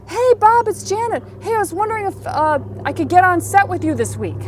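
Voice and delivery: high, loud, eager phone hello; pushy self-centred caller. Retold or imitated. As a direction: call up brimming with all-about-me eagerness. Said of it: "All about me, all about me".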